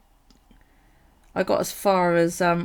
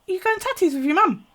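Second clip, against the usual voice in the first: high, loud, sing-song mum voice; imitating mum; 12 semitones higher, 6 dB louder than usual. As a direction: play the mum's chirpy question, pitched high.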